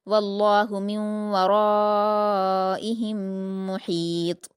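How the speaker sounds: slow, melodic Quran recitation; tajweed recitation. Shown as a demonstration. Teacher reciting the verse for the student. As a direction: chant the verse with elongation.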